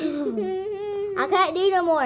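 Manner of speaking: slow, laughing mouth full; laughing through full mouth. Real, not acted. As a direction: gasp it out laughing.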